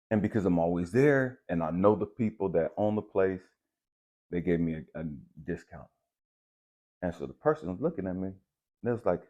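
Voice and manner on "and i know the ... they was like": usual speaking voice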